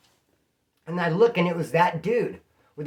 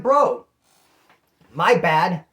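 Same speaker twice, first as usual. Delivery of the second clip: defensive retort; 6 dB louder, much slower than usual. Retold or imitated. To the rude cellmate, a loud sarcastic apology. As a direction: throw the apology back at him.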